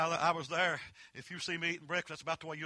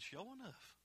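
quiet reply; 5 semitones higher, 15 dB quieter, slower than usual. Retold or imitated. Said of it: "I said".

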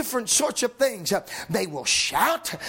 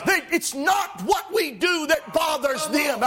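rising, shouted gospel preaching; preaching at full force; 7 semitones higher, 3 dB louder than usual. Acted. Preacher to his congregation, shouting, fervent. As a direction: lift the congregation with fiery, shouting delivery.

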